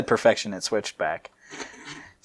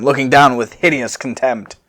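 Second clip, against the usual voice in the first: ordinary delivery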